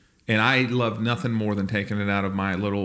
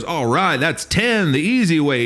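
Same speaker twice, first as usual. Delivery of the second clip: barker boss; 5 semitones higher, 5 dB louder, slower than usual. Retold or imitated.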